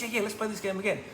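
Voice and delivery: high, quick eager mouse; little girl mouse. Acted. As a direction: rattle it off in a keen squeak. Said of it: "She goes".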